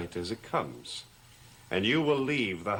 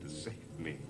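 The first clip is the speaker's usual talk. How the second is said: soft, low, incredulous echo; bitter disbelief; 6 semitones lower, 13 dB quieter than usual. Acted. Master echoes his servant's claim, soft and bitterly incredulous. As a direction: throw the words back, quietly mocking.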